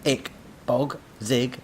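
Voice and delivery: high glitchy robot syllables; robot noises. Acted. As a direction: sputter like a broken robot. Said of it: "began making strange noises".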